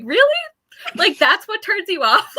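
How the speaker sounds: high, loud disbelief; incredulous. Retold or imitated. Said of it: "And I'm like".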